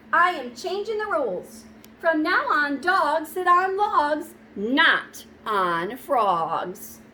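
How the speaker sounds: loud, slow frog announcing new rules; defiant frog. Acted. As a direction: put your foot down as the frog.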